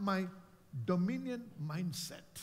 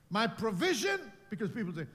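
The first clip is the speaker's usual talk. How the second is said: ordinary talk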